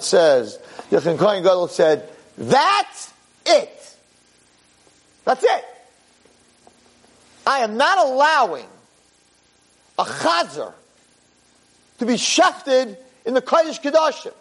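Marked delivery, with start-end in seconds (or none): 2.4-14.3 high, ringing declaration of outrage; outraged vow. Acted. Preacher voicing the High Priest's outraged refusal at the Temple, high and ringing. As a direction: cry it out like a priest who has had enough.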